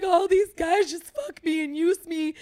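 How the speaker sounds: high, loud, hammed-up tearful wail; mock crying. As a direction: imitate a woman bawling her complaint. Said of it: "She's now crying. She's like".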